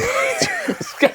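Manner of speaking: high-pitched burst of laughter. Real, not acted. Host cracking up amid the argument, high laugh.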